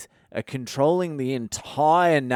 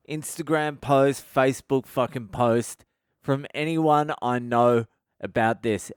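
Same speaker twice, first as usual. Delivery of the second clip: ordinary talk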